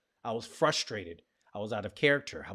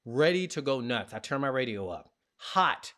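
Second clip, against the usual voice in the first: ordinary talk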